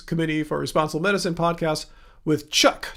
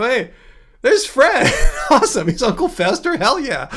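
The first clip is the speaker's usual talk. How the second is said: higher, louder, laughing excitement; delighted recognition; 7 semitones higher, 5 dB louder than usual. Retold or imitated. Host reliving spotting Fred as Uncle Fester, laughing delight. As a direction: burst out gleefully with laughter.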